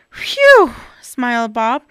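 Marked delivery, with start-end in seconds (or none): 0.1-0.7 relieved phew. Acted. Relieved sigh.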